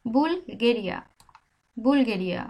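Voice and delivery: 「বুলগেরিয়া」 said with a Bengali b and falling at the end; Spanish Bulgaria. Shown as a demonstration. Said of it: "বিটা বয়ের মতোই উচ্চারণ হচ্ছে".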